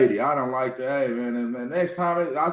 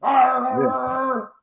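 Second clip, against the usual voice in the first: mock angry growl; 6 semitones higher, slower than usual. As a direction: growl like an angry dog, half joking.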